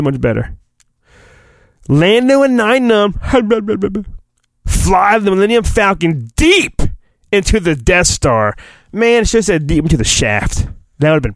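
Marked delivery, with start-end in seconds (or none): none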